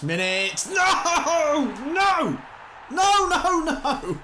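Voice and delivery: conceding a last-minute goal, screaming no; anguished shout. Real, not acted. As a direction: yell at the screen in despair.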